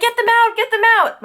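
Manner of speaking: very high, panicked retold cry; mock screaming. Screaming about spiders in her bed, mock high-pitched panic. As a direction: imitate a frantic scream, not full volume. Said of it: "I start screaming even more".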